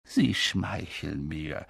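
soft, slow and modest; quiet Dumbledore. A narrator's reading. Dumbledore answers McGonagall's praise softly. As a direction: hush it, brushing off praise. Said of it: "sagte Dumbledore leise".

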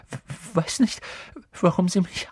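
stuttering. A narrator's reading. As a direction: stammer it out, high and anxious. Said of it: "doch er stotterte schlimmer denn je".

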